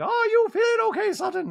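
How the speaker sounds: very high, loud falsetto; hammed-up squeal of concern; Dorit. Dorit checking on Sutton, high mock voice. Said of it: "Dorit's like".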